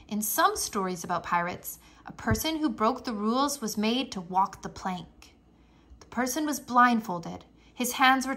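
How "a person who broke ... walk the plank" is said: ordinary delivery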